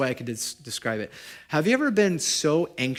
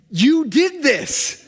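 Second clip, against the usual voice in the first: awed reply; 11 semitones higher, 8 dB louder, much slower than usual. Retold or imitated. Woman answers him from street.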